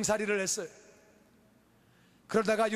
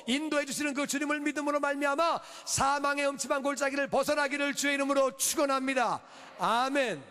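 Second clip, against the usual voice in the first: high-energy sermon delivery; impassioned preaching; 4 semitones higher than usual. Real, not acted. Pastor urging congregation to believe God protects, impassioned preaching. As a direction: preach it out with ringing conviction.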